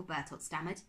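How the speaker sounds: usual speaking voice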